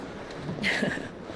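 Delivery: a quiet airy chuckle; soft breathy laugh. Acted. Gav chuckling after Clive ignores his greeting, quiet and airy.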